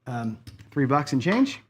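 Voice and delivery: ordinary talk, nothing marked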